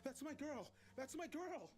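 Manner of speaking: high, anguished shouting; screaming. A narrator's reading. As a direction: cry out desperately for a loved one. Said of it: "I screamed".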